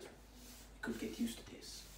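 slow, breathy whisper. Acted. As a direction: savor each word in a whisper. Tsar savors power softly.

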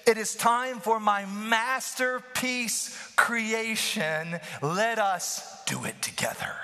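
slow grand words falling into a hushed whisper; God's voice, building to whisper. Acted. Preacher voices God to the church, grand then whispered. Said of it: "he says".

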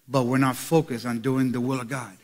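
sudden soft, low aside; dropped voice. Acted. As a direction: drop it down to make the point. Drops voice on key point.

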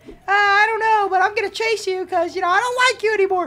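high squeaky villainess voice; threatening Speaker Woman. Acted. Speaker Woman starting to chase host, high petulant threat. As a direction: tease a threat in a high put-on voice.

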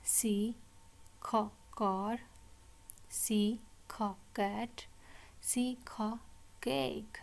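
"सी", short "ख", then "कार", each set apart; letter, sound, word. Shown as a demonstration. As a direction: repeat drill "C, ख, cat" with pauses between parts. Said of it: "again हम इसको repeat करेंगे". ("सी, ख, कार" अलग-अलग हिस्सों में)

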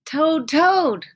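high, loud call; crying out. A narrator's reading. Frog crying out to Toad inside the house. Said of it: "cried Frog".

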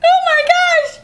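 shrill frightened cry; high startled shriek. Acted. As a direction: scream it in fright.

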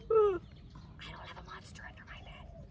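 high, unvoiced frightened whisper; scared whisper. A narrator's reading. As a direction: deny it in a hushed fear. Scared denial about monster.

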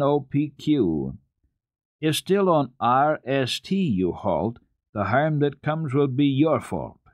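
slow, carefully spelled-out rhyme reading; clear and distinct. Acted. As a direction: read each letter slowly and clearly, like a lesson. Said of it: "read very clearly and distinctly".

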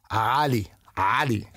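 "Ali" opened with a guttural Arabic A; Arabic pronunciation. Shown as a demonstration. Showing the Middle East pronunciation, guttural "Ali". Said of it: "how to pronounce the actual name".

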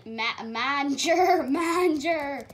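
slow, loud, stretched calls of manager; drawn-out calling. Real, not acted. Gamer calling the manager over and over, slow and loud. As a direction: drag each manager out to get attention.

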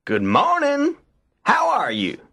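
high, sing-out rabbit greeting; Brer Rabbit. A narrator's reading. Rabbit tries greeting the tar baby, high and eager. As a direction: try a high-pitched hello as a rabbit. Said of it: "Brer Rabbit tried again".

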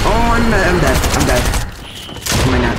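high, loud yell of defeat; shouting in dismay. Real, not acted. Gamer yelling at his own death, high loud shout. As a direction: shout it out, dead again. Killed in game, yells.

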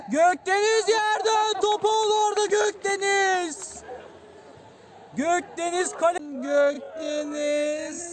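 high, loud excited call; screaming football commentator. Real, not acted. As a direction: shout the play loudly.

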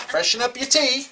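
high-pitched mock English accent; hammed-up English tea-time voice. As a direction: offer it in a put-on English accent. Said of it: "that's what they say and do over in".

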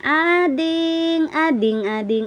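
high sing-song call of the name; baby talk. Acted. Calling baby name repeatedly. (mataas na malambing na tawag)

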